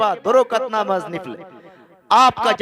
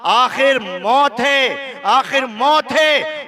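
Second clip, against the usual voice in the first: slow, drawn-out, rising warning, a little higher and louder; solemn warning; 5 semitones higher, 4 dB louder, much slower than usual. Acted. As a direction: stretch out मौत है as a solemn reminder. Preacher warns of death.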